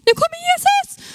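very high excited scream. Retold or imitated. Patient overwhelmed by the doctor's call, excited scream. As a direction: let it rip in a high squeal. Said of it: "förlåt för webbstreamingen att jag skrek".

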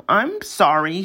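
ordinary delivery, nothing marked

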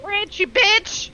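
high, slow indignant snap; mock retort. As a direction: spit the insult back in character. Player retorts in character.

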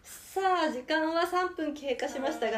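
high sing-song play-by-play, laughing; breathless rising goal commentary. Pretend. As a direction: call each wobble like a match finish. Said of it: "ずっと実況して".

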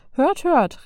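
mock-heralding in a silly high voice; hammed-up town crier call; disguised voice. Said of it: "mit verstellter Stimme".

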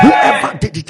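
loud preaching shout. Acted. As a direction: hurl the words out loud. Preacher repeats the line louder.

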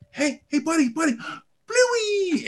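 high, bright sing-song calling; dad calling his baby's name in a high sing-song; excited call to baby. Acted.

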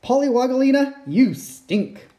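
high, loud playful tease; teasing. Acted. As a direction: tease the baby. Teasing baby sister.

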